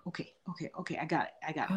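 low, very soft acquiescence; retold giving in, softly and low; subdued acceptance. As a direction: drop the voice and quietly go along. Retells herself meekly accepting it.

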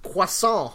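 "croissant" as kwah-SAH, silent t; French-style pronunciation. Shown as a demonstration. Countering co-host in argument, French-style pronunciation. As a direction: say "croissant" with kw and nasal ending. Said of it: "nor is it pronounced".

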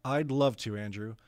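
ordinary delivery

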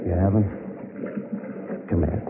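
low, soft and intimate; tender. Acted. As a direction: draw her close gently. Romantic moment on boat.

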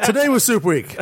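laughing through the words. Real, not acted. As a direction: giggle while saying it. Laughing while repeating.

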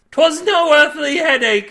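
high, loud impassioned protest; crying out. A narrator's reading. Milby protesting to Cade, crying out. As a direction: cry out against being doubted. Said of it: "Milby cried".

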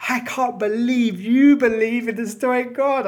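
high, loud, drawn-out mock disbelief; mocking incredulous critic. Mimicking critics to listeners, scandalized mock disbelief. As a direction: ham up a scandalized critic, stretching every word. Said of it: "And then people go".

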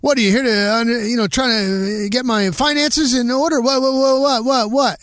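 imitating Ditka teasing the reporter; mocking coach. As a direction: rattle off the whats in disbelief. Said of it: "He goes".

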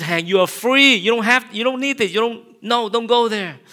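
high, loud urging to himself; loud self-rebuke. Retold or imitated. Pastor shouting at himself to stop spiraling, loud urging. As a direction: shout yourself out of it.

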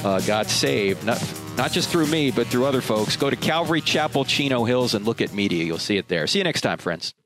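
normal talking voice

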